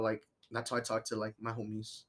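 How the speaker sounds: ordinary delivery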